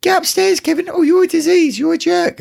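very high squeaky mock voices; mock taunting. Nasty relatives in Kevin's head, high mock taunting. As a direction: imitate nagging relatives way up high.

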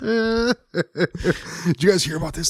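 high, giggling mock announcement; breaking up with laughter mid-line; laughing through words.

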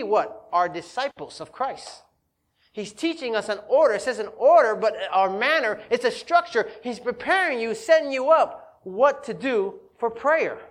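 ordinary talk, nothing marked

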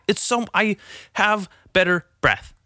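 ordinary talk

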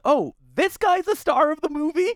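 high, incredulous question; surprised. Retold or imitated. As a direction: ask it in disbelief. Imagined viewer's surprised question.

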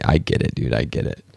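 lightly voiced, fading to a whisper; low amused Best Buy guy. Best Buy man voiced laughing.

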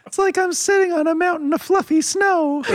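squeaky mock-cuddly voice; high sappy voice. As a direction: gush like a cozy movie fan. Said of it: "his voice just goes up two octaves".

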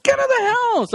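wife ordering the intruder out, mock indignant; mock bark. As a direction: order him out of the house. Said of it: "I'll be like".

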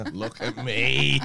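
loud, long-drawn comic cry; Bridesmaids quote. Acted. Joining partner's film quote, drawn-out cry. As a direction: stretch it out loudly, film-quote style. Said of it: "quote Bridesmaids".

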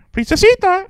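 very high, loud bird squawk; mimicking bird. Bird repeating the cheater's phone talk, high squawk. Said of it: "the borough would be like".

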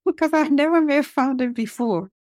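high, laughing through the words; amused, high-pitched explanation with real laughter. Real, not acted. Laughs recalling friend's homemade fondant.

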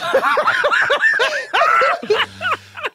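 high, loud cackling laugh; loud shrieking laugh. Real, not acted. Cracks up after gaffe.

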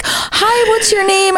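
very high friendly hello; put-on high-pitched welcome; retold sweet greeting. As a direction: imitate greeting a fan in a squeaky voice. Retells greeting the fan.

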